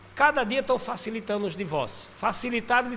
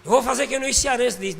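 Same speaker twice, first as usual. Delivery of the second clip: usual speaking voice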